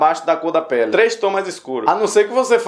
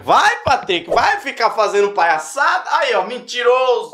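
high-pitched nagging telling-off; hammed-up reproach; mock scolding; 5 semitones higher than usual. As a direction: scold yourself as someone else would. Acts someone scolding him.

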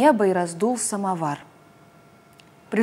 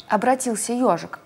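ordinary delivery

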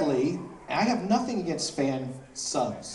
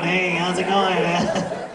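a raspy clown greeting; Krusty the Clown; 4 semitones higher, 4 dB louder, much slower than usual. Retold or imitated. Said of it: "I sound like the clown on".

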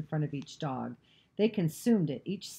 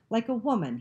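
short, higher-pitched scornful jab; contemptuous; 6 semitones higher, 4 dB louder than usual. A narrator's reading. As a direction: toss it off with mild contempt. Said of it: "he added with contempt".

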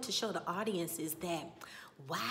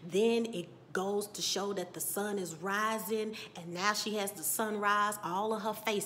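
ordinary delivery

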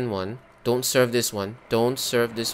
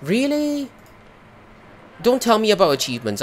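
irritated snap; 9 semitones higher, 5 dB louder than usual. Real, not acted. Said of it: "I'm so mad right now".

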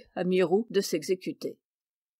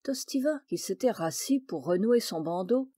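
ordinary delivery, nothing marked

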